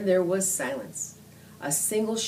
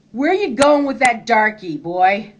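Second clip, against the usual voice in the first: louder, higher hostile yell; 4 semitones higher, 7 dB louder than usual. A narrator's reading. Confederate stopping the boys on road, hostile yell. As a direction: call out to stop the boy. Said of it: "one yelled at me".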